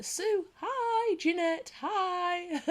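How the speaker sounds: high, bright-pitched hellos to each name; calling hi to each viewer by name, high and excited; excited greetings. Real, not acted.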